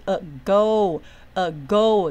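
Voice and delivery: "a go" as two separate beats, "a", then "go"; split in two syllables. Shown as a demonstration. Teacher showing a word's two syllables, "a go" said in separate beats. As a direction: say "a go" syllable by syllable, each held apart. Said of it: "这两个音节咯".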